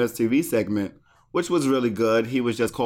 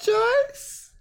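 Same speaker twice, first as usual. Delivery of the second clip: high mom call. Retold or imitated. Calling out name, mom voice.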